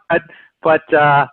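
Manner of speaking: ordinary talk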